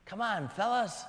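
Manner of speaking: calm Jesus. Retold or imitated. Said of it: "it's me, Jesus".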